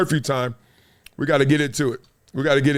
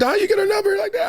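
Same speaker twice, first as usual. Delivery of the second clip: high, fast incredulous question; imitating amazed friend; faster than usual. Friend asks how he got the number, mock amazed. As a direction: rush it out high, mock disbelief.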